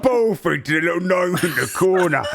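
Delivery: loud, high ogre voice; rowdy ogre. Acted. Ogre urging John to pick on gnome, loud rowdy voice. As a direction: egg him on boisterously. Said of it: "You see, Tom goes".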